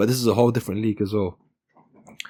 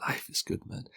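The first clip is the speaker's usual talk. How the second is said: whispered aside; 13 dB quieter than usual. Real, not acted. After laughing.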